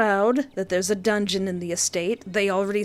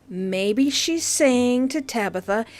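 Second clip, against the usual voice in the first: ordinary delivery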